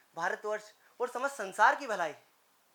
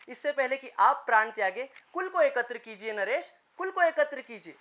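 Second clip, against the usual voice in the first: raised, higher impassioned plea; urgent appeal; 4 semitones higher, 4 dB louder than usual. Acted. Envoy urging the old king to unite the clan, urgent and raised. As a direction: press the plea with mounting pitch and force.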